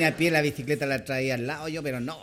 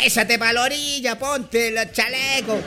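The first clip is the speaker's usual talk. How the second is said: louder, higher mock shouting at a cyclist; mock angry driver yell; 7 semitones higher, 4 dB louder than usual. Driver scolding a cyclist on the road, hammed-up yell. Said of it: "le dicen unas cosas".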